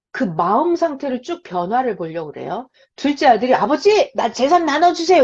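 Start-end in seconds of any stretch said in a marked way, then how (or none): 3.5-5.2 cocky demand. Retold or imitated. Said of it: "승승장구 자신만만하죠".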